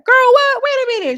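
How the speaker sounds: high, loud mock disbelief; imitating a stunned castmate; mock shocked squeal. Rolly's shocked reply, retold.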